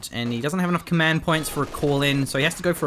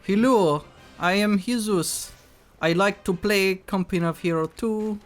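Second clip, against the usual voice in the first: put-on higher, halting Italian-sounding Spanish accent; Jezelin; 5 semitones higher than usual. Caster impersonates a Spanish player for his co-caster, in an Italian-sounding accent. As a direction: do a slow mock Italian-Spanish accent, pitched up. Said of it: "my Jezelin impersonation would be more like".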